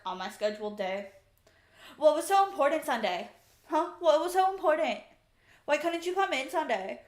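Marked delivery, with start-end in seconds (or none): 2.0-7.0 imitating an irritated coworker, a bit louder and higher; hammed-up scolding from the coworkers; mock angry coworkers. Coworkers scolding her, acted.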